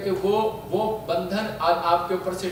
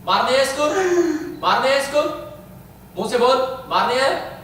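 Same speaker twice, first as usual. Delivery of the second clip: short, raised, demanding bursts with long waits; exorcist interrogation; 8 semitones higher, 6 dB louder, faster than usual. Real, not acted. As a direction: confront the spirit and demand an answer. Interrogates and orders the spirit. (आत्मा से कड़क पूछताछ)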